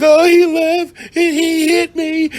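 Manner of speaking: very high, slow whimpering falsetto; fake victim act. Girlfriend putting on a victim act for police, high and pitiful. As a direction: overact the helpless victim. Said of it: "an Oscar-winning performance".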